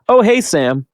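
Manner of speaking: high-pitched, chirpy imitated hello; retold friendly greeting. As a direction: imitate someone greeting a familiar face, high. Imitating a staffer greeting him.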